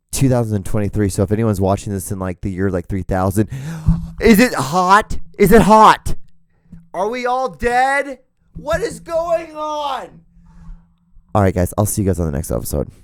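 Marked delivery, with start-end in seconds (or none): 3.5-4.1 mock gasp. Mock alarm at future heat. 4.2-10.2 rising hammed-up alarm, much higher, ending in a slow shout; mock panic. Podcaster asking future viewers in hammed-up growing panic.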